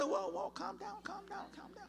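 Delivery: high, soft, soothing plea to calm down; calming someone down. Retold or imitated. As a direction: hush an excited talker gently. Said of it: "they get loud and say".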